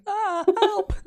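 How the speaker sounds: high, loud, drawn-out wail; hammed-up squeal for rescue; mock cry for help. Playing someone as the fire is coming, mock panic.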